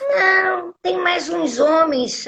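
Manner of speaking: slow, oblivious mock reply; retold absent-minded actress. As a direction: lightly imitate an airy, clueless woman. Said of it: "tava sempre no ar".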